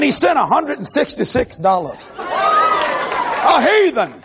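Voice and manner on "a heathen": mock squeal. Preacher mocking the donor playfully.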